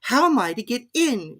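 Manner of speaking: put-on high, pleading girl's voice; a polite girl asking; young Alice. A narrator's reading. Alice figure voice.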